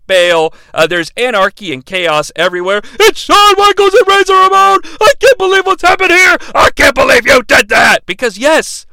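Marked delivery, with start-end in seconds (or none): 3.0-8.0 high, loud thrilled call; excited announcer call. Retold or imitated. Announcer as heroes rush in, excited shout. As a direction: hype the big save.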